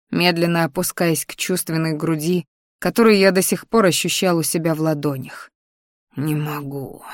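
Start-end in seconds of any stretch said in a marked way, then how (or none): 6.2-7.0 quiet, drawn-out agonised groan; pained moan. A narrator's reading. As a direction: softly moan it, worn down. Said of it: "вымученно простонал".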